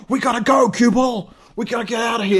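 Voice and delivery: high, loud, frantic yell to flee; panicked shout. Retold or imitated. Crying man yells at Cue Ball to flee the ice tornado, panicked shout. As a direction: shout at your friend to run now.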